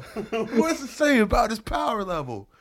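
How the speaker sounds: high-pitched cartoon shout, held back in volume; Vegeta impression. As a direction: play an excited anime character. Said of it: "I look like Vegeta".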